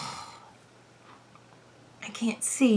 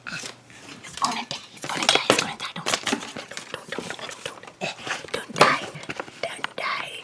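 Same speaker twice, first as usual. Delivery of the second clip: whispered, pleading repeats; panicked whispering. Real, not acted. Streamer whispering pleas as battery dies. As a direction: whisper pleas under your breath.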